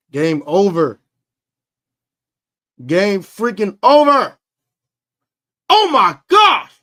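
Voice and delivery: high loud slow shout; excited yell. Real, not acted. Fan celebrating the win, high excited yelling. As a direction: yell it out in joy.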